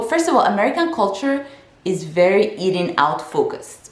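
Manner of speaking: ordinary talk, nothing marked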